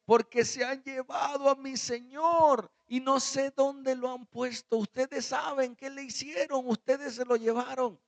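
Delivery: high, urgent pleading; anguished. Acted. Mary tells the angels her Lord was taken, anguished. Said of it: "Ella estaba angustiada".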